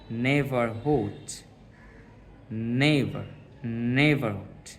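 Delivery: "নেভারহুড" slowly, weight on "নে"; teacher breaking "নেভারহুড" down to show the stressed "নে". Shown as a demonstration. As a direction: lean on the first syllable of "নেভারহুড". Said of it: "এটা stress করে পড়তে হবে".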